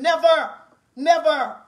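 loud, emphatic angry refusal; defiant. Real, not acted. Mother rejecting any help feeding her children, defiant.

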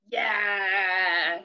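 ordinary delivery

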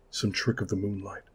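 ordinary delivery, nothing marked

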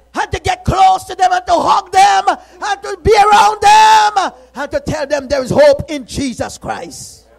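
shouted preaching, high and loud; a preacher at full cry, shouting and ringing; full-force preaching. Real, not acted. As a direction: thunder the warning from the pulpit.

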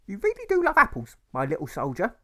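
high, bright mother's voice brushing the question off; cheerfully change the subject like a mum; mother dodging. Acted. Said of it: "his mother had replied".

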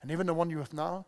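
very soft, low quoted dialogue; hushed acted exchange. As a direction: play both sides quietly, almost under the breath. Preacher acts out woman and Jesus.

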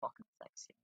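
breathy whispered aside; whispering. Real, not acted. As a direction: whisper it under your breath. Muttered reaction to character.

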